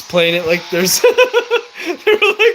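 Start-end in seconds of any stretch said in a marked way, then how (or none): 1.0-2.6 high laugh breaking into words; cracking up mid-sentence. Real, not acted. Laughing at game moment.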